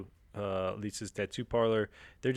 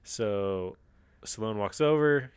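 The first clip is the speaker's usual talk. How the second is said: ordinary talk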